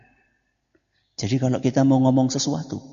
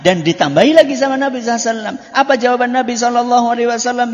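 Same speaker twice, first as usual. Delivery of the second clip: raised preaching voice; 11 semitones higher, 6 dB louder, much faster than usual. Acted. Preacher addresses class forcefully.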